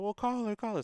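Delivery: light mom impression, high and brisk; imitating mom. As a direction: pitch up into mom urging him to just call. Mom's reply voiced in story.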